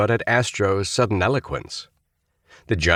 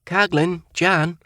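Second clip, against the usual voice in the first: nervous cadet; 12 semitones higher, slower than usual. A narrator's reading. Cadet sounding off.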